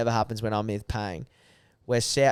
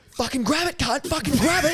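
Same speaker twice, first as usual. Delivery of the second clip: high-pitched mock shout; abusive order. Mate ordering him to grab the fish, abusive shout. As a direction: imitate a frantic mate yelling orders. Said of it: "He's abusing me".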